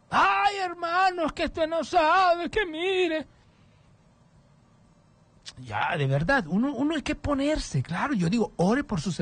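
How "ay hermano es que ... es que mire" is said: high, drawn-out mock whine; quejándose. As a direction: wail the complaint in a put-on, much higher voice. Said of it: "usted déjese de quejarse del Señor". (lamento quejumbroso fingido, muy agudo)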